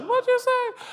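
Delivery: high squeaky indignant question; shocked Baptist. Acted. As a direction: gasp it out, scandalized. Baptists react to clapping joke.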